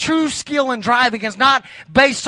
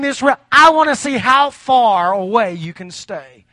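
each "I want to see" rising louder, driving the list home; building preacher's refrain; 2 semitones higher, 3 dB louder than usual. Acted. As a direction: preach it harder with every line. Escalating repeated preaching refrain.

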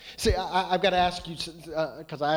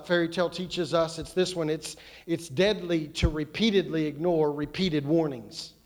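ordinary talk

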